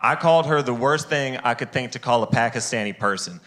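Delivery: ordinary talk, nothing marked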